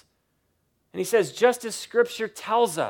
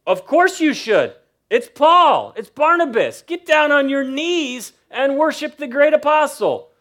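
higher and puffed-up, playing the proud apostle; mock pompous agreement; 6 semitones higher, 4 dB louder than usual. Apostles imagined welcoming the crowd's worship, mock pompous. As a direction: soak up the worship with mock self-importance. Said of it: "be like".

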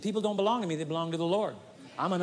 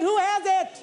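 higher, louder, pressing "Who has it?"; louder repeat; 10 semitones higher, 4 dB louder, slower than usual. Shown as a demonstration. Preacher repeats to the congregation, higher and louder. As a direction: demand the answer to "Who has it?". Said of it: "I said".

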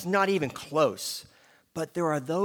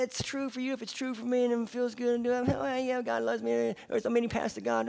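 higher, breezy mocking voice; mock relativist; 6 semitones higher than usual. The preacher parodies believers who say every path leads to God, breezy and mocking. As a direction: parody the feel-good believer.

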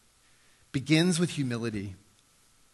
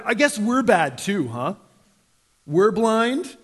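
sarcastic mock; 6 semitones higher, 6 dB louder, slower than usual. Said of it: "to call a Pharisee blind".

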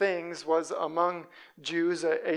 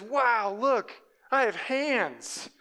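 higher, drawn-out wonder, acting the child; delighted little son; 6 semitones higher, slower than usual. Son proudly showing parent his hands, delighted discovery. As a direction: exclaim it as a smiling, amazed kid. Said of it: "he's just got this big old smile".

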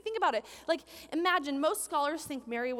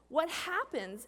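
ordinary talk, nothing marked

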